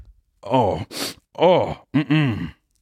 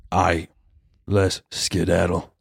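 lower, slow cowboy-style rescuer line; rescuer drawl; 5 semitones lower, much slower than usual. Pretend. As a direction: stretch it out like a cool hero. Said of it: "I'd be like".

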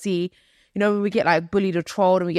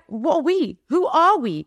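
higher-pitched, bewildered questioning; exaggerated bafflement; mock identity crisis; 7 semitones higher than usual. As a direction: plead in playful confusion, pitch jumping up. Acting out their identity confusion.

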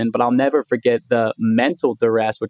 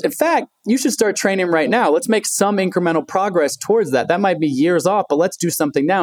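mother's eager voice, pitched higher; imitating mom; 7 semitones higher than usual. Son retelling mother urging him to train, higher pitched. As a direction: push him to start training right away. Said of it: "And she goes".

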